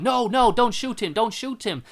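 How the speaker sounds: high, loud, frantic no; pleading shout. Retold or imitated. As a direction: beg him not to shoot. Doctor begging the cop.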